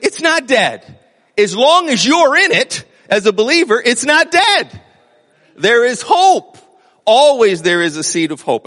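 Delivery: rising, loud declaration of hope; fervent preaching. Real, not acted. Preacher answering despairing friends, loud fervent declaration. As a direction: drive it home from the pulpit.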